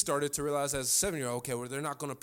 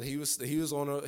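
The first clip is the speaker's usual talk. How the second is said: ordinary talk, nothing marked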